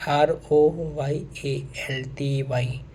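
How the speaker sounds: slow, letter-by-letter spelling. Shown as a demonstration. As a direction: spell it slowly, one letter at a time. (धीरे-धीरे अक्षर-अक्षर बोलकर)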